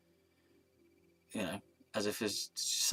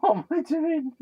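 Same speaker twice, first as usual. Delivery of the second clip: high squeak; 7 dB louder than usual. Real, not acted. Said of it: "This is weird".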